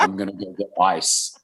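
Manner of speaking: lightly voiced stock movie excuse; a hint of a hammed-up movie line; movie cliché line. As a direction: say it like a character in a film stepping out. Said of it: "what everyone says in the movies".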